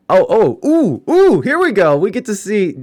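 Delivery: high, loud excited oohs as the clip starts; getting hyped for what's coming. Real, not acted. Streamer hyped as the fight clip finally loads, excited.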